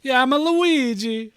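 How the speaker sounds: put-on high, hammy Italian pizza-man accent; cartoon Italian accent, played up. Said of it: "Now you're, like, Italian".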